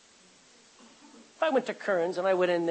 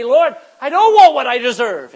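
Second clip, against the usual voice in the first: crying out; 7 semitones higher, 15 dB louder than usual. Acted. Preacher voices a sinner's plea.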